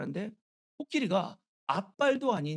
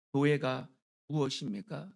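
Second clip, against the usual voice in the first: ordinary delivery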